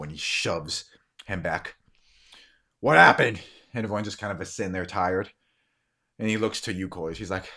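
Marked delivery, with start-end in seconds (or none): 2.8-3.4 raised, forceful question; loud demand. Acted. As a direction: demand an answer from the whole group. Man demands an answer again.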